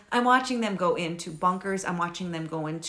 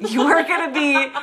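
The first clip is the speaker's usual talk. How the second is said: laughing self-scolding; 4 semitones higher, 9 dB louder than usual. Retold or imitated.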